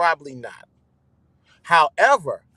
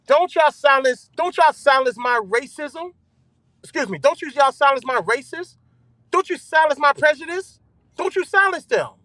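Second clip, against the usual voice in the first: raised, higher pleading rant; heated rant; 8 semitones higher than usual. Real, not acted. Streamer ranting at his viewers, heated. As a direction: push it up, fired up at the chat.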